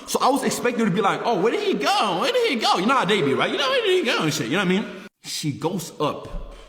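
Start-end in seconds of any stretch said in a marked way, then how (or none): 1.2-2.8 high-pitched mock drunk girl; rising into a higher, giggly imitation; drunk girl impression. Drunk girl looking for a hiding man, imitated high and giggly. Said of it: "She was so drunk". 3.5-4.2 high mock drunk girl; drunk girl impression. Imagined drunk girl hunting for him, hammed-up high voice. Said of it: "She was so drunk".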